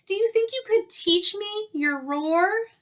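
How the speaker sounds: high, polite little squeaky voice; timid mouse voice. Acted. Mouse politely waking the sleeping lion, high and timid. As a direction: nervously wake a sleeping giant.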